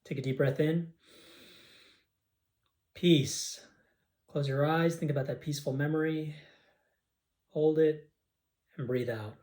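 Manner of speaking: calm breathing cues with pauses; guided breathing. Acted. Guiding listeners to breathe and rest, calm and paced. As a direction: lead them gently through the breath.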